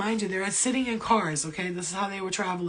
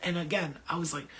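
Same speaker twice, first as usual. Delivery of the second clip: ordinary delivery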